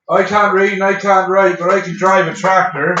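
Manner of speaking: slow rural Norfolk drawl; norfolk accent. Retold or imitated. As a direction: put on a broad Norfolk accent. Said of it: "Suffolk people and Norfolk people used to say to us".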